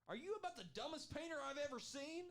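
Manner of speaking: high, loud insulting yell; drill sergeant. Pretend. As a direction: scream at the dumbest painter ever. Bob Ross as drill sergeant.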